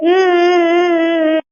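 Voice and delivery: loud acted sobbing; crying. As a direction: cry in long hummed wails. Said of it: "இப்ப எதுக்கு தேவையில்லாம அழுதுட்டு இருக்க".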